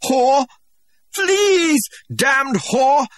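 high, loud enraged shout; furious shout. Acted. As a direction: spit the insult out in a rage. Savage rages at her.

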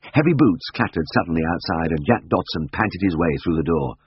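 plain reading voice

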